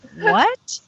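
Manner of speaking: very high, incredulous squeak; baffled retold reaction. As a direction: let it jump up in comic disbelief. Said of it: "I'm sitting there going".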